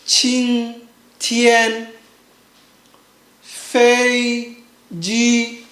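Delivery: QING, TIAN, FEI, JI each drawn out level; first tone. Shown as a demonstration. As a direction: separate syllables qing, tian, fei, ji on one flat pitch. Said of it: "make sure it's flat".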